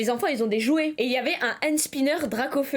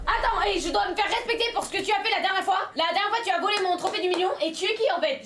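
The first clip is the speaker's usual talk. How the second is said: mock tough guy; 8 semitones higher than usual. Mock confrontation skit.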